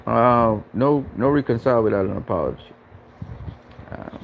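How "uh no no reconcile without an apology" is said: usual speaking voice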